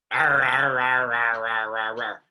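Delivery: gruff, loud pirate growl, fading off. Retold or imitated. Actor hams pirate noises for the director's take. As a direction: ham up the pirate noise as a joke. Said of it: "You mean like a pirate?".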